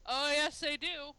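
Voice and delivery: usual speaking voice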